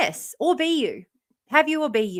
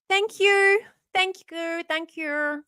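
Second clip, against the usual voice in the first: bright thanks; 7 semitones higher, much slower than usual. Real, not acted. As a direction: lead the thank-yous off high and bright. Repeated thank-yous fading.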